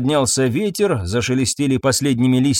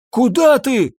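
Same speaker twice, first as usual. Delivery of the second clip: usual speaking voice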